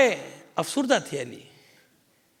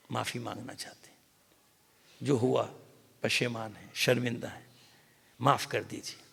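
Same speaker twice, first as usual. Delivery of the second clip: hushed plea; 6 semitones lower, 8 dB quieter than usual. Acted. Visitors begging forgiveness tearfully.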